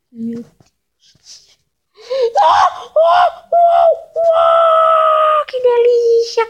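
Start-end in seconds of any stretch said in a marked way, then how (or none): 2.4-6.5 delighted squeals. Real, not acted. Delighted at eating players.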